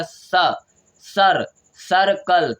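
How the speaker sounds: loud, drawn-out "सर्कल" in growing pieces; teacher's piece-by-piece "सर्कल"; sounding out. Shown as a demonstration. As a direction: build "सर्कल" up slowly: sa, sar, sar-kal. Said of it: "तो क्या हो गया?".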